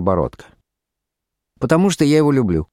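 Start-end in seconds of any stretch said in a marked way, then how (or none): 1.6-2.7 higher, fast, brisk retort; impatient wife. A narrator's reading. Wife tells husband of her lover, briskly. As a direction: toss it back quickly.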